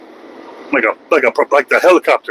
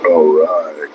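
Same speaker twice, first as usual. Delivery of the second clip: deep low growl. Acted. Deep drawn voice.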